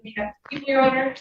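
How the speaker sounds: ordinary talk, nothing marked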